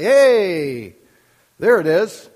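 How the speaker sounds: loud, delighted "hey" of finding it; happy discovery. Acted. Pastor acting out a reader getting happy about scripture, loud and joyful. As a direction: call it out as a joyful find. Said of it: "get happy about and say".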